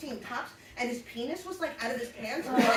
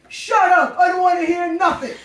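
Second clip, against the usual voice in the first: loud barked order, higher pitch; mock shout; 4 semitones higher, 13 dB louder than usual. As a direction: yell it to shut the kids up. Frankie yelling in car.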